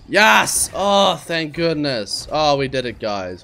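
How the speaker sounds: loud cheer then slow relieved sigh; triumphant yes. Real, not acted. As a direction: celebrate the win out loud. Relief after success.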